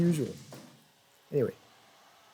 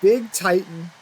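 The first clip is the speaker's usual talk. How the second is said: loud, higher name call; announcing the winner; 5 semitones higher, 6 dB louder, slower than usual. Real, not acted. Naming the new champion.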